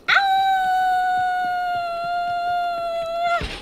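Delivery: very high, sustained shriek; drawn-out high-pitched scream; long high scream. Real, not acted. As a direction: scream out one long note. Sudden scream in game.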